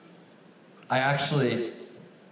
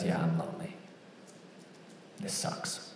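lonely sigh; 7 semitones lower, 12 dB quieter than usual. Acted. Inner voice of a lonely single.

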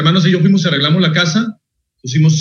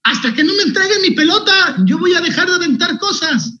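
put-on high, strained kid's ultimatum; defiant kid yelling; 13 semitones higher than usual. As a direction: shout the ultimatum like a stubborn kid. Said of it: "Y le dije".

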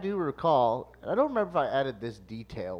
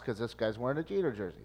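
ordinary talk, nothing marked

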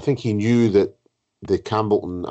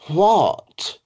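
high, louder-ending mock disbelief; retold astonishment, pitched way up and falling; incredulous; 10 semitones higher, 4 dB louder than usual. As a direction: imitate the host's scandalised high "what?". Host repeats her astonished question.

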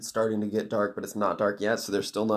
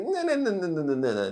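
high protest sliding down to normal pitch; hurried mock refusal; 8 semitones higher than usual. Girl halts the villain's deal, hasty comic refusal. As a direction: rattle off a string of refusals, starting high.

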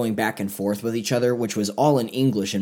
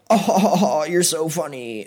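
higher, over-the-top forced giggle; mock a fake sitcom laugh; fake acted laugh; 6 semitones higher, slower than usual. Imitating the cast's forced laughing at a bad joke. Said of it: "an over-the-top kind of acted laugh".

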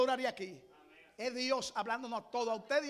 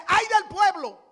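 mock accusing cry; 6 semitones higher, 11 dB louder, much slower than usual. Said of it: "Isaías no dice".

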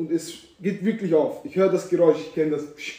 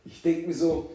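normal talking voice

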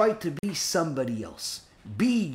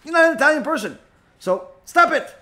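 loud, high, exasperated rebuke; acted scolding; 10 semitones higher, 7 dB louder than usual. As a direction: lay down the law at raised pitch. Acted listener scolding the rabbi.

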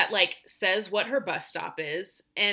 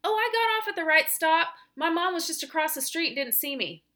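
put-on much higher, breezy child voice; kid's voice; 8 semitones higher than usual. Teacher imitating the student explaining the bus stop, high and breezy. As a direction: play the carefree kid. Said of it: "And the kid goes".

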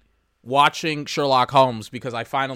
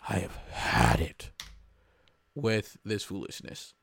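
fed up; 6 semitones lower, 7 dB quieter, slower than usual. Retold or imitated. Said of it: "because I'm like".

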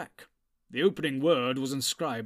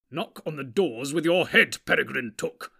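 irritated retort; 7 dB louder than usual. A narrator's reading. Irritated wizard snapping at Pippin.

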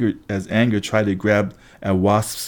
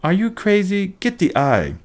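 high, incredulous cry; urgent retort; 10 semitones higher than usual. A narrator's reading. Annabeth rejects the backpack plea, high urgent retort. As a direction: fire back in a high, urgent voice.

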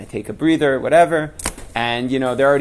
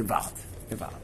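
hushed, breathless whisper of wonder; awed whisper; 4 semitones lower, 13 dB quieter than usual. Real, not acted. Telling listeners of the Kotel story, whispered awe.

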